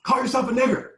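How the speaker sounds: high, loud hateful yelling; going off on us. Retold or imitated. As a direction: scream the order at the boys. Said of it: "he starts just going off on us".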